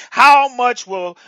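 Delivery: hear me, thrown out loud and high; shouted call. Real, not acted. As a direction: shout it to wake the room. Preacher calls congregation to attention.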